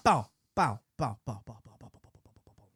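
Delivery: mock barking that fades away to a breath; imitating the neighbor's barking dog; dog barking imitation. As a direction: bark like the dog, trailing off into nothing.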